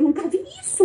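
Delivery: high, amazed exclamation; retold amazement. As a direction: say it as if you can't believe it. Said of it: "Ela falou".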